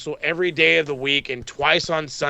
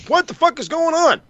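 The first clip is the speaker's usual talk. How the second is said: baffled outburst; 8 semitones higher than usual. Retold or imitated. Fan baffled as the crowd claps for a heel, shouting. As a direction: throw up your hands in disbelief. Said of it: "and I'm like".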